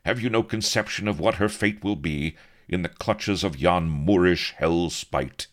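aghast Avery. A narrator's reading.

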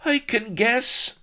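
high, loud, slow sarcastic snap; snapped retort. A narrator's reading. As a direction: snap back, drawing the words out. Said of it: "snapped Blood".

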